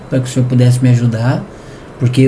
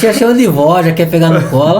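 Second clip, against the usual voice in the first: ordinary talk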